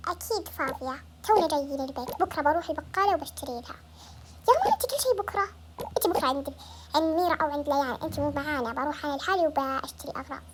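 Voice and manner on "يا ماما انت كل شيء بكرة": put-on high-pitched child's complaint; complaining. Child to mum about endless postponing, complaining. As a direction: protest to mum, starting high.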